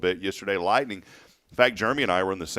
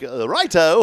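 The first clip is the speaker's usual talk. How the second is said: put-on loud, higher, botched Spanish-guy accent; failed Spanish accent; 8 semitones higher, 9 dB louder, slower than usual. Host mocking his own accent attempts, failed Spanish accent. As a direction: badly fake a Spanish accent, landing on British. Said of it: "Change your accent to a Spanish guy".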